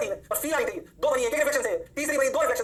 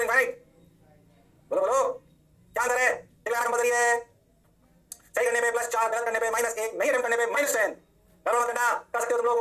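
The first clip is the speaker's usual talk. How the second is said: ordinary talk, nothing marked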